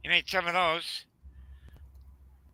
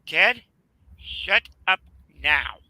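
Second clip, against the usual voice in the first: louder, emphatic telling-off, word by word; retold firm command; 6 dB louder, much slower than usual. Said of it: "And I said".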